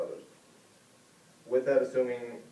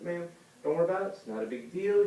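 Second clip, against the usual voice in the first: dismissive reassurance; 7 semitones higher than usual. Retold or imitated. Officer brushes off upset driver.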